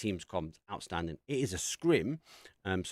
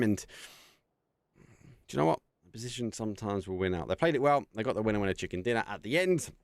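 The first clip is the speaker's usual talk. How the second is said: a little raised, settling caster voice; winding-down play-by-play; 3 semitones higher than usual. Real, not acted. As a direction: bring the call down after the win. Wrapping up the live cast.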